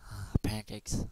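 usual speaking voice

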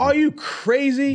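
high, slow, disbelieving "are you crazy"; incredulous. Real, not acted. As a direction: stretch it out in total disbelief. Friends arguing, disbelief.

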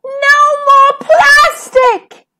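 high, slow, loud scream of protest; girl screaming from the water in anger. A narrator's reading. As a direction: shout it to the sea. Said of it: "and screamed".

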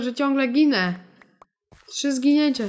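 ordinary talk

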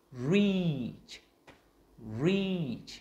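"reach" high and loud, long ee, clear ch; emphatic high, falling "reach" for students to copy; every sound drawn out. Shown as a demonstration. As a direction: stretch "reach" through R, E and Ch. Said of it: "bắt nguồn từ hai từ R".

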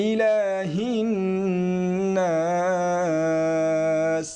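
ইলাহিন with a warm breathy ha from the throat. Shown as a demonstration. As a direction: push warm air from the throat on the ha. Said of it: "হা গলার ভিতর থেকে একটা গরম বাতাস".

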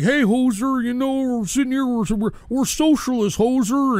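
lightly put-on Canadian hoser accent; mock drinking Canadian. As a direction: mockingly imitate Canadians out drinking. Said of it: "those Canadians were out there drinking and being like".

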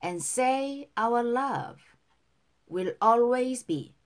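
slow, careful model reading for repetition; pronunciation model. Shown as a demonstration. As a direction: say each phrase slowly for learners to copy. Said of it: "발음 다시 한번". (따라 읽도록 천천히 또박또박)

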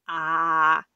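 long loud open-mouthed ah. Acted. Big Bird showing his throat, loud sustained ah. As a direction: say ah wide for the doctor.

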